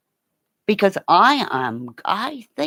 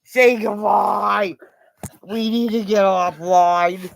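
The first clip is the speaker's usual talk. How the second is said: voicing the dog; 3 semitones higher, 5 dB louder, slower than usual. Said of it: "He's going".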